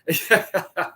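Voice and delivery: real burst of laughter. Real, not acted. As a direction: laugh at a friend's jibe. Amused by chat joke about grooming.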